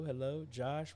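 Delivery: normal talking voice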